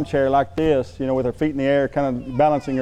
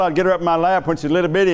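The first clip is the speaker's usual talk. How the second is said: ordinary delivery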